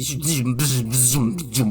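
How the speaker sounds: buzzing lightsaber noise. Pretend. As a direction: hum it like a swinging lightsaber. Imitating lightsaber sounds. (bzyczenie miecza świetlnego)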